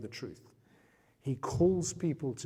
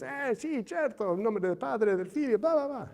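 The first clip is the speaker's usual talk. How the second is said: much higher, rattled-off Italian blessing; Italian priest; 9 semitones higher than usual. Retold or imitated. Italian priest blesses Rocky in a hurry, mock Italian. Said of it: "He goes".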